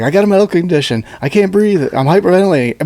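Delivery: fast, breathless-sounding mock excuse; rushed mock plea. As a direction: rattle off the excuses. Imitating tased agent.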